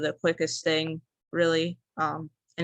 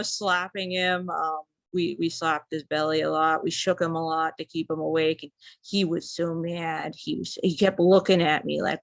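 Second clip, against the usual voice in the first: usual speaking voice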